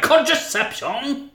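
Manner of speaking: high, drawn-out outraged cry; mock French outrage, stretched out and squeaky; incensed Frenchman. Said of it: "He is incensed by the sight".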